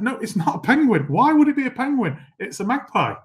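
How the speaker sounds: high-pitched incredulous correction; mock-exasperated, pitch leaping up in disbelief. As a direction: retell it as baffled protest. Retelling his exasperation at her guess.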